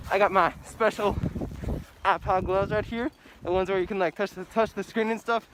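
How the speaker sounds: loud and breathless, pushing through snow; panting, shouting over the wind; trudging through snow. Real, not acted. Walking through deep snow.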